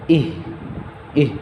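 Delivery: ইহ stopped on a breathed h; held jazm. Shown as a demonstration. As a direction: hold the h of ইহ, no vowel after. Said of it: "এটা ধরে রাখতে হবে". (ইহ-তে জজম ধরে রাখা)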